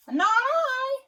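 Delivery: high, loud goose voice; goose refusing. Acted. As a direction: honk the refusal. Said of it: "said the goose".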